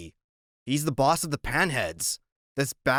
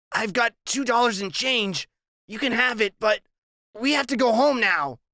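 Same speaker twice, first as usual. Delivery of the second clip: put-on higher, overly formal kid; a scared kid trying to sound proper; polite nervous boy; 9 semitones higher than usual. Narrator voices Freak.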